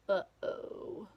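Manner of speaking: whispered mock-worried uh-oh. A narrator's reading. Reader to listening children, whispered mock worry. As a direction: breathe it like trouble is coming. Reacts to story danger.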